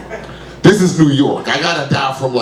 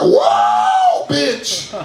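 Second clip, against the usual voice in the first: squealing high whoa after a crash; young guy loving the rush of a car hit; thrilled whoa. Retold or imitated. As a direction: shriek with delight at being hit. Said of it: "just get up and be like".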